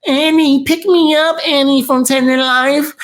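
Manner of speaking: high, whiny voice mocking the rival as a beggar. Mocking his rival's begging in a high whine. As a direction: whine it like a needy leech.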